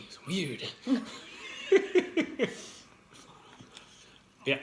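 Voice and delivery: ordinary talk, nothing marked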